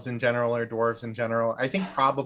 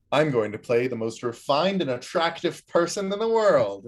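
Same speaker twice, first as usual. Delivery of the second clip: mock player boast; 5 semitones higher, 4 dB louder than usual.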